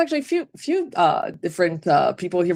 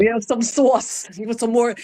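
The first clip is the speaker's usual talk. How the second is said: New York Italian host; 6 semitones higher than usual. Retold or imitated.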